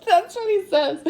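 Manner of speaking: high-pitched, helpless giggly aside; really cracking up; laughing. Real, not acted. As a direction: squeeze the words out through laughter. Laughing after her punchline.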